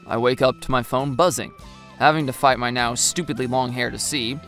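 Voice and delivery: ordinary delivery, nothing marked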